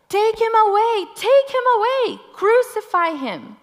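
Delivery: high, loud shouted chant; crowd shouting. Acted. The crowd shouting at Pilate to crucify Jesus, very loud. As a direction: shout it like a mob. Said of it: "But they shouted".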